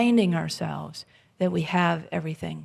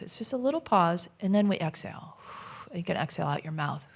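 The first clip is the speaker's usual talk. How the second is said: usual speaking voice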